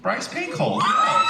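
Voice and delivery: high, loud shouted answer; loud exclamation. Real, not acted. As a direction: shout it out as if obvious. Cheering fan question, mock outrage.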